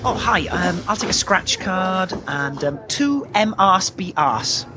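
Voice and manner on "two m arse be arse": "M arse be arse" as separate, slow syllables; mispronunciation. Acted. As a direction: slowly misread Mars bars as "M arse be arse". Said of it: "You mean Mars bars".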